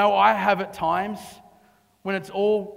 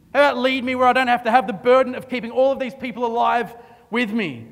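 fast, louder, higher bargaining; mock demanding; 5 semitones higher, 6 dB louder, faster than usual. Preacher voicing David's imagined demands, fast and loud. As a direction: rattle off the demands to God.

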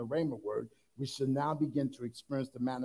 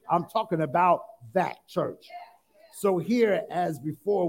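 loud, raised-pitch sermon delivery; preaching at full force; 5 semitones higher, 7 dB louder than usual. Real, not acted. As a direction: drive each phrase home to the congregation.

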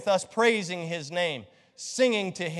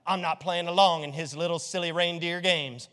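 mocking reluctant member; 3 semitones lower than usual. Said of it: "some of you didn't even want to participate".

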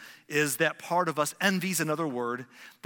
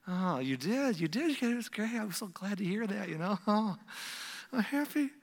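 high, strained fake delight with little laughs; fake happiness; 6 semitones higher than usual. Jealous person fakes joy at someone's news, high and forced. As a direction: overdo being glad, pitched way up without extra volume.

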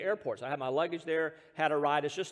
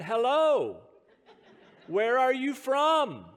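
loud, slow, higher over-enunciated greeting; loud slow hello; 6 semitones higher, 5 dB louder, much slower than usual. Retold or imitated. Preacher mocking himself greeting the couple too loudly. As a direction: talk too loudly to a foreigner. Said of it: "I said".

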